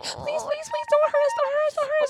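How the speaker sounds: high squeaky begging; tiny pleading dwarves. Retold or imitated. Shrinking dwarves begging Ole, high and squeaky. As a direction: plead like tiny frightened creatures. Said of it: "They were like".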